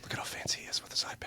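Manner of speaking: hushed teasing whisper. Real, not acted. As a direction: whisper it as an aside. Teasing aside during interview.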